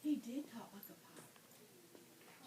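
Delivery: hushed, secret whisper. Real, not acted. Storyteller whispers the secret to the children, hushed whisper. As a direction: lean in and whisper it.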